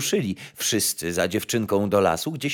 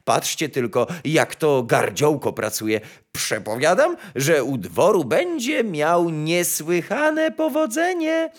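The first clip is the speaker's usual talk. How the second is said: raised, effusive courtier voice; gushing chamberlain; 6 semitones higher than usual. A narrator's reading. Chamberlain predicting success at court, high gushing praise. Chamberlain gushes over the bird.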